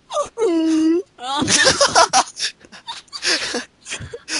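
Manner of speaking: loud barking sea lion call; sea lion noise. Pretend. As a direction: bellow like an attacked sea lion. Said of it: "That was a pretty good sea lion impression".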